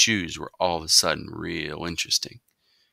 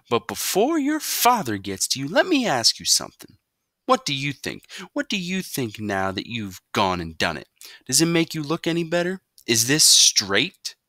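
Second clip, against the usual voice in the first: higher, pointed questioning; sarcastic mother; 8 semitones higher, 5 dB louder than usual. A narrator's reading. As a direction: needle him with questions.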